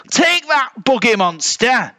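high, feisty little bear shout; high-pitched battle taunt; attacking yell. A narrator's reading. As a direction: yell it as you hurl a stick. Little bear hurls a stick.

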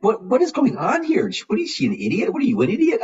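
high, astonished outburst; incredulous disbelief. Retold or imitated. Doctor recalling her shock at a nurse's baby talk, incredulous. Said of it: "I'm like".

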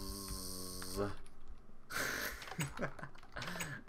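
soft laugh trailing off; real giggle. Real, not acted. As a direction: giggle at your own silliness. Laughs at own bit.

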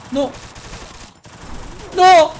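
yelling in panic; panicked shout. Real, not acted. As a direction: shout it, under fire. Getting shot in game.

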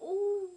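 sharp gasp of sudden pain. Real, not acted. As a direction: gasp as the iron burns. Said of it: "I just burned my hand".

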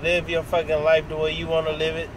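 normal talking voice

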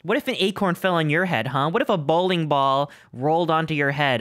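usual speaking voice

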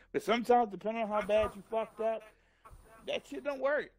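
ordinary delivery

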